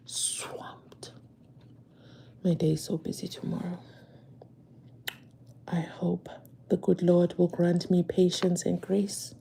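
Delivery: tired whisper; whispered weary aside. Real, not acted. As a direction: breathe it out, worn out.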